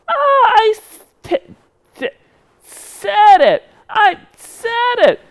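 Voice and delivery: high, loud puppet squeal, stuttering on the S; excited. Pretend. Puppet celebrating success, high stuttering squeal. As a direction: squeal triumphantly, tripping over "said". Puppet thrilled at getting it right.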